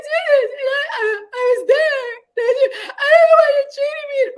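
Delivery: high, loud tearless fake sobbing; dry cry. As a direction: wail for sympathy without a tear. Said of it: "what they give you is the dry cry".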